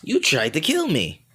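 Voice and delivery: toned-down creature voice, moderately high; Boo. Acted. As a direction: swoop up high, then fall. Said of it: "forget it".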